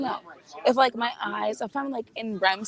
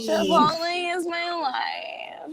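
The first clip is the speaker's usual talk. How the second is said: hammed-up proclamation; 6 semitones higher, much slower than usual. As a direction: proclaim it like a creed. Echoing the line bigger.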